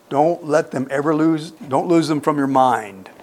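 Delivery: normal talking voice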